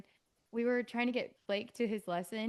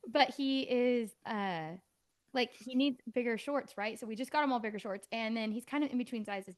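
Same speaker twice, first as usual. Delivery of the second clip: ordinary delivery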